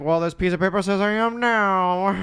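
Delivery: high, slow villain voice with a laugh; villain's retort. Retold or imitated. Villain answering protesting townsfolk, slow gloating retort. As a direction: stretch it out, then cackle like a melodrama villain. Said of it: "It's like".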